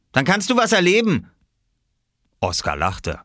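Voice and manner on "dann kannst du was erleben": high, raised warning; threatening. A narrator's reading. Bigger boy promising trouble to the little one, threatening. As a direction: finish the threat with voice pitched up. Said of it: "drohte er".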